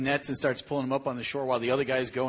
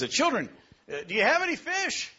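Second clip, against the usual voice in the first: calling out from the beach; 10 semitones higher than usual. Acted. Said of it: "He yells out from the beach".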